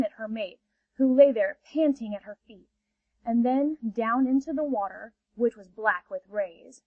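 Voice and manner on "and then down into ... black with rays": usual speaking voice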